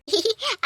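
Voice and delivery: short high laugh; nervous laugh. Real, not acted. Sister caught scheming by her brother, nervous laugh.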